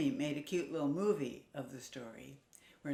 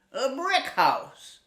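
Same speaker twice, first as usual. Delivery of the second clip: proud piglet; 8 semitones higher, 9 dB louder, slower than usual. Acted. Piglet answers wolf.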